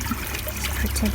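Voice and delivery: whispered. Real, not acted. Admiring flowers quietly.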